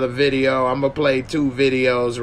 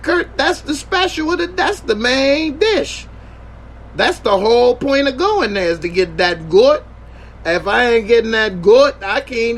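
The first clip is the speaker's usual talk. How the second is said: high, eager pitch for the special dish; excited insisting; 8 semitones higher than usual. Retold or imitated. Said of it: "I'm like".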